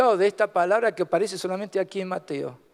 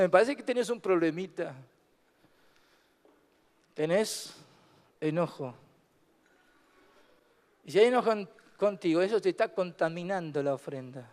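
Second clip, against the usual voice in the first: gentle reproach; 5 semitones lower, 5 dB quieter than usual. Acted. Jesus voiced gently diagnosing anger.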